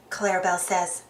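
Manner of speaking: ordinary delivery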